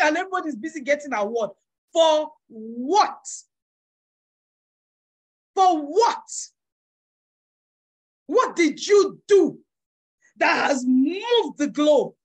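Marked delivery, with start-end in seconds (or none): 1.9-12.1 louder, slow demanding question; preaching challenge at full force. Acted. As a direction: demand it of the congregation.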